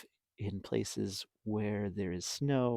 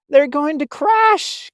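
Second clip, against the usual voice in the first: much higher, louder, slow alarmed gasp; 14 semitones higher, 13 dB louder than usual. Acted. Stellaluna gasping as the birds fall. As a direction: gasp in fear for friends. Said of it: "gasped Stellaluna".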